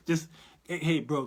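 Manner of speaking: normal talking voice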